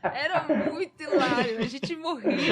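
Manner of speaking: talking through real laughter; laughing. Real, not acted. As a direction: crack up while recalling it. Laughing at own retold joke. (falando às gargalhadas)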